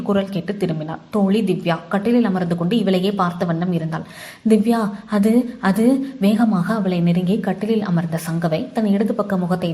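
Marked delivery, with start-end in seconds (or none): 4.5-6.2 halting, on the edge of tears; tearful faltering. A narrator's reading. Shaken Sangavai to her friend Divya, tearfully faltering. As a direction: falter slowly as if about to cry. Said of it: "விசும்பலுடன் லேசாக அழ ஆரம்பித்தாள்".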